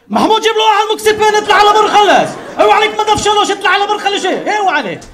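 high, very loud mimicked yelling; sounding completely different. Pharmacy worker shouting to his mate for the medicine, mock loud yelling. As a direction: yell harshly across the shop. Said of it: "הוא תמיד נשמע אחרת".

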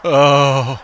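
loud sudden groan of pain. A narrator's reading. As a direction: clutch the chest and groan. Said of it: "he groaned".

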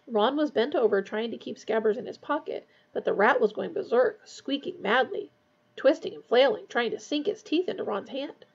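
plain reading voice

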